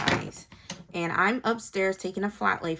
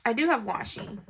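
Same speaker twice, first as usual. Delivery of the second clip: ordinary delivery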